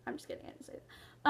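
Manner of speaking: barely audible unvoiced aside; whispered aside. Real, not acted. Admitting to viewers she never said it, whispered. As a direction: whisper the confession under your breath.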